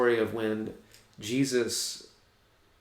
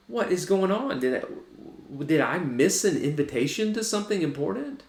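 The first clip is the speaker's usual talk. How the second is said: indignant surprise; 7 semitones higher than usual. Acted. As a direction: play the left-out brother, stunned. Older son confused in field.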